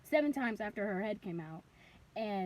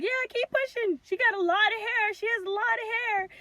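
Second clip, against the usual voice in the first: high, loud urging; excited delivery team; 9 semitones higher, 8 dB louder than usual. Retold or imitated. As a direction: cheer a mother on mid-push. Medical team urging her on, retold.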